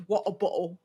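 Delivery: "bottle" said with the t swallowed as a glottal stop; "What a bottle" with glottal stops for the t's; Cockney dropped t. Shown as a demonstration. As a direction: drop the t in "bottle". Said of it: "They don't say their T's".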